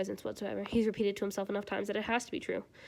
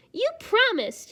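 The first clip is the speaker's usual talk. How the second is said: put-on high, slow childish voice; high childish reminder; 14 semitones higher, 8 dB louder, much slower than usual. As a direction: sing it like a happy kid. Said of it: "he reminds him, speaking with his mouth full".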